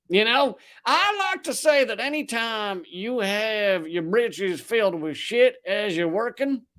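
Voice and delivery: put-on Southern accent. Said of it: "he's using the Southern accent".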